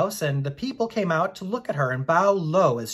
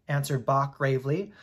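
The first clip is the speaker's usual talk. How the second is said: ordinary delivery, nothing marked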